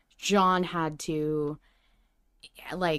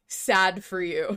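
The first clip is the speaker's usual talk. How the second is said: normal talking voice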